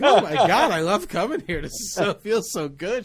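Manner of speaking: high-pitched, delighted mock gushing; flattered girlfriend. Girlfriend enjoying the old ladies' attention, hammed-up delight. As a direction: imitate her basking in the compliments. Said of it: "She's like".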